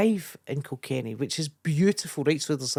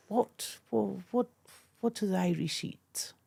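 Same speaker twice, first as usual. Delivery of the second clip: a light higher-pitched posh English twit, trailing into a whisper; posh plummy Englishman; 5 semitones higher, 6 dB quieter than usual. Retold or imitated. Mimicking the posh comedian's question about Irish food, lightly plummy. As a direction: lightly send up a clueless plummy toff. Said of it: "quite posh, quite plummy".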